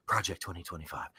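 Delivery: low, hushed mock-dramatic echo; mock ominous. Host echoing the secret agenda's name, mock ominous and hushed. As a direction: drop it low and soft, playing at dread.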